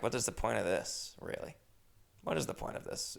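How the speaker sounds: soft, near-whispered self-talk; muttering to himself. Retold or imitated. Host in his apartment questions the hard sandal, soft mutter. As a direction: grumble it under the breath.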